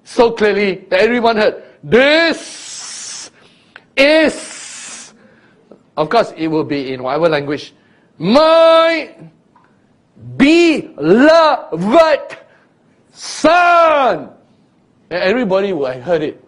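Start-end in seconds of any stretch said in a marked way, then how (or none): 1.8-5.1 much higher, loud and drawn out; blurred heavenly call; unclear trumpet voice. Retold or imitated. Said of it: "It was not exactly enunciated". 8.2-14.3 much higher, loud, drawn out with a laugh breaking in; blurred heavenly call fading into laughter; unclear trumpet voice. Retold or imitated. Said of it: "It was not exactly enunciated".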